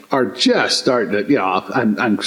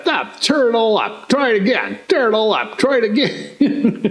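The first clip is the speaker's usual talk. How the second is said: high-pitched mock orders; imitated drill instructor; 7 semitones higher than usual. Instructors inspecting recruits' beds, mock commanding. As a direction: play an instructor rejecting work, voice pitched up.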